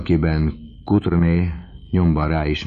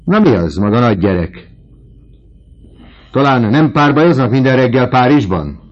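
high, loud mocking challenge; loud taunting; 9 semitones higher, 10 dB louder than usual. A narrator's reading. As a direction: needle the student with raised voice. Vautrin taunts the indignant student.

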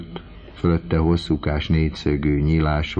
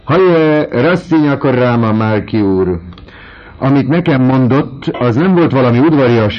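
loud, high jeering call; mocking shout; 8 semitones higher, 8 dB louder than usual. A narrator's reading. Vautrin jeering loudly after the student. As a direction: bellow a sneering greeting across the hall.

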